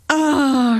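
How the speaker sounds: high startled ah; falling scream. Acted. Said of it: "schrie sie".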